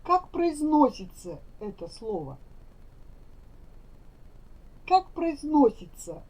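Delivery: ordinary delivery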